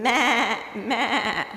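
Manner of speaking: a quick impression of bleating sheep. Preacher mimics the campground sheep at morning, wavering bleat. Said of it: "really loud".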